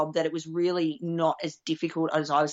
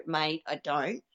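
ordinary delivery

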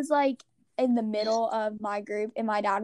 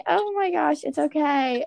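higher-pitched imitation of a fussing dad; dad's retold reassurance; 5 semitones higher than usual. Imitating dad to a crying child, high-pitched reassurance.

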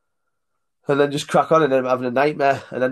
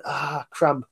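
usual speaking voice

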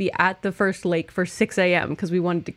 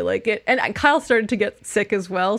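normal talking voice

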